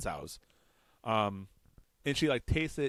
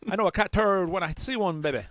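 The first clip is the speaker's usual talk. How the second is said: high, loud wrestler-style brag; Dusty Rhodes; 9 semitones higher, 6 dB louder than usual. Acted. Joking about the cat turd, hammed-up loud impression. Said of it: "you chose Dusty Rhodes".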